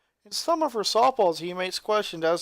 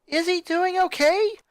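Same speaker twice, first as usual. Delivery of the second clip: high, loud, worried rising question; anxious high question; 12 semitones higher, 6 dB louder than usual. Acted. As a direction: beg to know he's fine. Anxious sister asks about brother.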